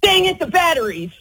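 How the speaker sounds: mock frustrated realization. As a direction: act out finding the batteries forgotten. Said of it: "how do you say it?".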